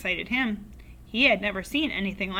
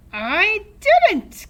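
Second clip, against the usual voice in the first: high, loud witchy cackle of a voice; cackling old woman; 12 semitones higher, 11 dB louder than usual. A narrator's reading. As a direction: cackle it out like a gleeful old hag. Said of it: "cackled the old woman".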